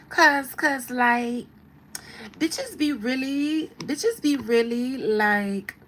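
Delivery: loud, drawn-out imitation of her accent; exaggerated accent with swooping high pitch; [name]. Commentator channels Cardi about being in love, in her accent. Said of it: "I'm sorry if I butchered her accent".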